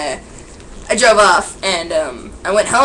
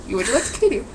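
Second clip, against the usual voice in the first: ordinary talk, nothing marked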